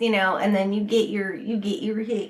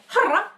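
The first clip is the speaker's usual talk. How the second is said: quick high squirrel chirrup; short, high-pitched animal trill; squirrel noise; 10 semitones higher than usual. Retold or imitated. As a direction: make a little squirrel's sharp noise. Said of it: "like a little squirrel".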